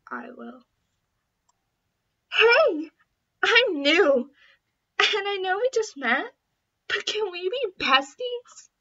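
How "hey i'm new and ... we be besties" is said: excited. Acted. Eager new girl introduces herself.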